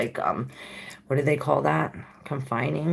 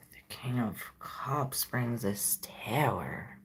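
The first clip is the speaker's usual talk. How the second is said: slow hushed whisper, much softer; secretive slow whisper; 9 dB quieter, slower than usual. Real, not acted. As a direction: breathe the card name out slowly. Hushed card reveal.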